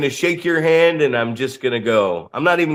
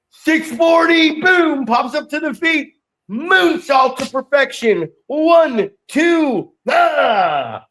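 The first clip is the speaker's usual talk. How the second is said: hyped commentary; 11 semitones higher, slower than usual. Pretend. Commentator calling a moonsault and pin, shouting high. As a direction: call the match like a screaming commentator. Hyped wrestling play-by-play call.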